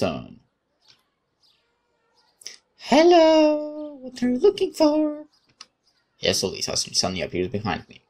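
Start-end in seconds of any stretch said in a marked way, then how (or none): 2.9-5.3 put-on much higher, bright girlish voice; cheerful young woman. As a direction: play the friendly girl who sneaks up, chirpy.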